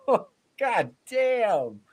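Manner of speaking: hammed-up stunned onlooker; mock crowd reaction. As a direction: stretch it out in mock awe. Fan reacting to the insult.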